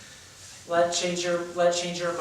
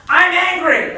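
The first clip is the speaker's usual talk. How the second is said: much higher and louder, hammed-up yell; voice-only angry outburst; overacted shout; 10 semitones higher, 12 dB louder, slower than usual. Shown as a demonstration. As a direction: shout it to fake the emotion with the voice. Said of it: "instead they kinda go".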